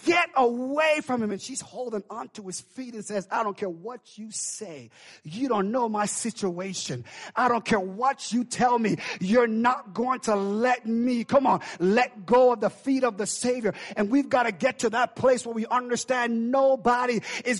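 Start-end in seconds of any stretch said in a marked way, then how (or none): 0.0-1.3 screaming. Acted. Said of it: "they're screaming". 3.3-13.8 defiant plea. Acted. Woman clinging to Jesus' feet.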